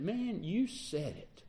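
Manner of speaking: quiet, slow, offhand "Man, you said it"; dismissive reply. Shown as a demonstration. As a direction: toss it off softly, like you don't buy it. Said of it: "does that mean that he's disagreeing with me?".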